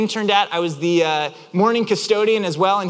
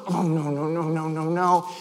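low, softer fretting; repeated mock-distressed muttering; worked up; 4 semitones lower, 4 dB quieter than usual. Driver retelling his reaction to the bump, worked-up fretting. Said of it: "I'm, like, worked up".

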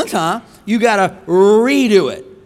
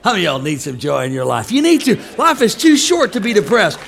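normal talking voice

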